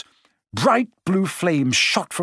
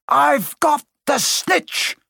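higher, slow, ringing shout of triumph; triumphant shout; 11 semitones higher, much slower than usual. A narrator's reading. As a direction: call it out to the whole stadium. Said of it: "he shouted".

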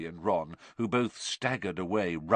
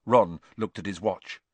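ordinary delivery

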